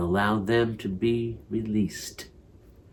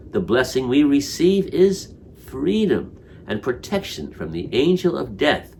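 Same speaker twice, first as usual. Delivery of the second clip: ordinary talk, nothing marked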